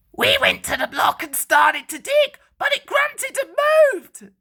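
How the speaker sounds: high, loud scared voices; frightened workmen. A narrator's reading. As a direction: workmen rushing out of the tunnel, frightened. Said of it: "they said".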